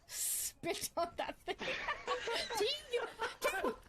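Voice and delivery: usual speaking voice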